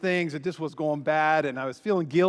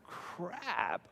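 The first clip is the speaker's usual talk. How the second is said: a much softer, deflated "Crap." said under the breath; dismayed under-breath crap; 12 dB quieter than usual. Retold or imitated. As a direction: let "Crap." sink out quietly in dismay. Dismay at bad marriage score.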